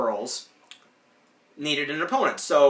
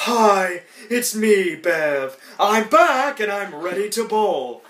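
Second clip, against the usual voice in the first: higher, louder, slowed fake lady voice; man faking woman; 6 semitones higher, 5 dB louder, much slower than usual. Ed in drag pretending to be Bev, higher fake lady voice. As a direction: pass yourself off as Bev badly. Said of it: "and he's like".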